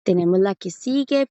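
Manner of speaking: usual speaking voice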